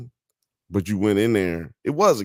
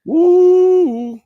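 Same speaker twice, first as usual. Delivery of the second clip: excited whoop; 10 dB louder than usual. Real, not acted. Food excitement.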